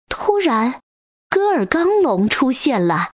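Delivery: ordinary delivery, nothing marked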